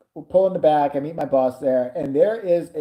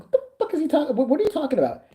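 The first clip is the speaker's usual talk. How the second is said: incredulous; 10 semitones higher, faster than usual. Retold or imitated. Incredulous quote to Steve.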